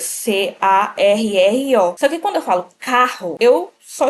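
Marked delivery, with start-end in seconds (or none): 0.0-1.9 slow letter by letter spelling; spelled letter by letter. Shown as a demonstration. Teacher spelling carro out for the class. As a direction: spell each letter slowly. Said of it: "A palavra carro tem cinco letras".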